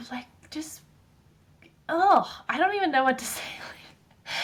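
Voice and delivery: breathy whispered exasperation, at a loss for words; a whispered ugh, words failing her; exasperated whisper. Real, not acted. Whispered exasperation mid-rant.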